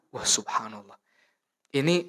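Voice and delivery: ordinary talk, nothing marked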